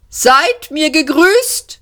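high, slow, hollow ghostly greeting; a hollow, shrill, disembodied voice from a dead planet. A narrator's reading. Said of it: "hohle, schrille, wesenlose Stimme".

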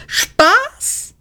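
sehr hoch, ungläubig kreischen; gekreischt. Acted. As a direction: das Wort Spaß schrill fragend hochziehen. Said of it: "kreischte Arthur". (ungläubiges Kreischen)

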